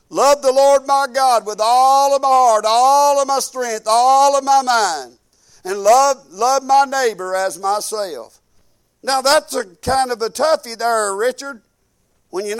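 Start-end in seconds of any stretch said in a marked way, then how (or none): 0.1-8.4 recited commandment. Acted. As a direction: intone it like scripture from the pulpit.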